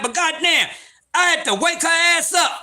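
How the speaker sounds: ordinary delivery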